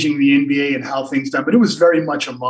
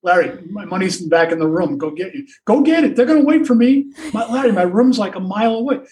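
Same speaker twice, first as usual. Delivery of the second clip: pleading whine; 7 semitones higher than usual. Retold or imitated. Teammate pleading with Larry.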